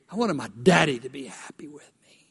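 soft whispered confession. Real, not acted. Preacher confiding his childhood wish to the church, whispered. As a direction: breathe it out like a secret.

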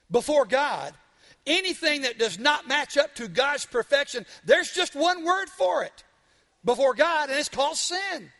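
pastor hammering the point home to the congregation; fiery preaching. Acted. Pastor preaching at full force to the congregation.

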